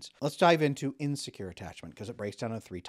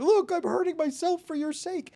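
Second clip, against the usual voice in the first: much higher, whiny, put-upon plea; mock martyr; 5 dB louder than usual. Anxious person guilt-tripping others, mock martyr whine. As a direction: play the long-suffering martyr.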